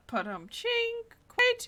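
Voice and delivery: high loud drum sting; rimshot noise. Pretend. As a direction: punch out a comic rimshot. Rimshot after a pun.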